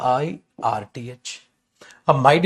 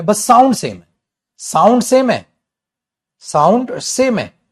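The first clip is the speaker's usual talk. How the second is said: Sound सेम है said louder and higher, each word hammered; emphatic drilling; 5 semitones higher, 6 dB louder than usual. Shown as a demonstration. Teacher stressing to students that sound is same, emphatic. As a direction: drive sound सेम है home slowly at the end. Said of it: "teacher drills key phrase".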